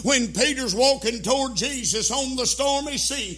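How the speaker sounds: loud, driving sermon cadence; fiery preaching. Acted. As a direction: preach it hard to the congregation. Preaching at full force.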